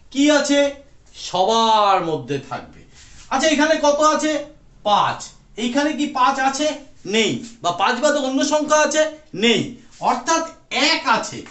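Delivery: ordinary talk, nothing marked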